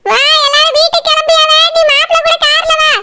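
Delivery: high-pitched motherly instruction; bossy mother. Acted. Mother sending the bride to the groom's car, bossy. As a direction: hurry her along in a high voice.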